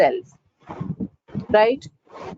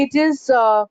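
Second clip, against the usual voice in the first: usual speaking voice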